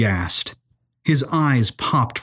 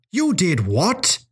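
drawn-out, high-pitched incredulous question; shocked disbelief; 7 semitones higher, slower than usual. A narrator's reading. Professor reacts to her visiting the woman's house, incredulous. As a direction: stretch it out in astonishment, pitch leaping up.

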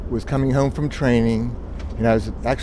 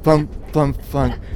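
usual speaking voice

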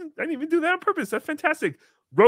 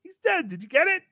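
ordinary talk

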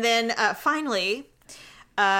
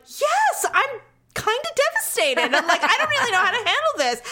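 mock devastated; 10 semitones higher than usual.

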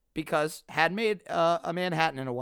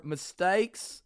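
slow dramatic; much slower than usual. Acted. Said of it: "drawn-out repeat".

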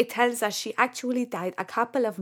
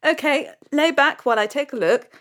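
usual speaking voice

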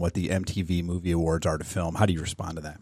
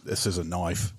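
put-on Australian accent; 2 semitones lower, 10 dB quieter than usual. Crocodile Dundee quote.

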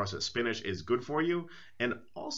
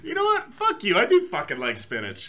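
hammed-up fury, higher and louder; mock outburst; 7 dB louder than usual. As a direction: play the podcast villain and blow up. Mock outburst, "heel turn" on podcast.

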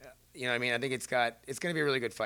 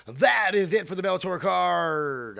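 higher, louder, slow announcer boom; 5 semitones higher, 6 dB louder, slower than usual. Shown as a demonstration. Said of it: "I just say that like an announcer voice again".

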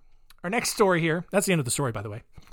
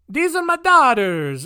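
high, loud, slow fake Portuguese accent; hammed-up Portuguese prince, pitched way up; bad Portuguese accent; 8 semitones higher, 6 dB louder than usual. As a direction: proclaim it in a badly faked Portuguese accent. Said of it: "I really don't know what accent Portuguese is".

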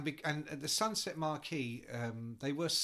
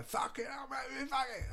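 band member shouting; 11 semitones higher than usual. Retold or imitated. Band members yelling in frustration at the manager, high shouted swearing. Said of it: "they'd be like".

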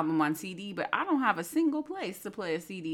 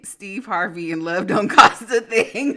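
laughing; 3 semitones higher, 10 dB louder than usual. Real, not acted. Reading a tweet, laughing.

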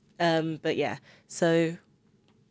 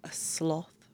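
soft breathy whisper; 11 dB quieter than usual. Real, not acted. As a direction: whisper it sheepishly. Whispered answer.

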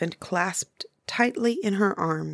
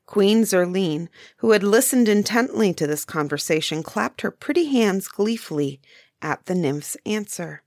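usual speaking voice

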